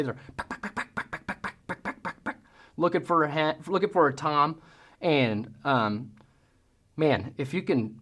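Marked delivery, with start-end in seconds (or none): 0.4-2.4 sharp clucking cut; voiceless clucks like a turkey hen. Shown as a demonstration. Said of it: "The hens are searching".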